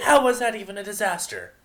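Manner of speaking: loud, high indignant question; outraged loud demand. Acted. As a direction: protest the charge. Vinyl indignant.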